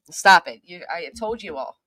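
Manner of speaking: ordinary delivery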